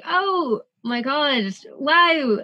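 high, slow, drawn-out shocked wow; stunned wow. Retold or imitated. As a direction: let the shock stretch out. Shock on learning who Max is.

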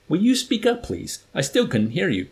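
high, loud exasperated order; acted officer demanding to be heard; irritated demand. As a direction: pitch it up sharply on the demand. Colonel berates the accused cadet.

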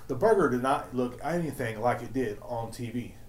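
ordinary talk

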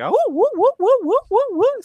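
very high, repeated ape whoops; imitated orangutan whooping. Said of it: "Starts doing that noise".

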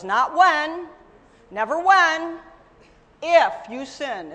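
loud, with "never" and "if" punched; stress on if. Acted. Preacher correcting the congregation, punching if. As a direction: hit "if" hard against "when". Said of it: "preacher stresses if".

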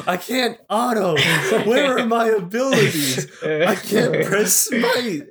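high, helpless whining friend; squeaky, slow, lost gamer voice; mock panicked Pranay. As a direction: play the flustered teammate who can't find his buttons. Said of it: "Pranay would be like".

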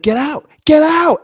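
slow, high, very loud scream; full-volume acted panic. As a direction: scream each command drawn out. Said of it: "I'm just screaming".

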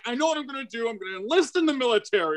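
high, fast, desperate pleading; hammed-up desperate teen, voice climbing high; frantic young self. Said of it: "I'm like".

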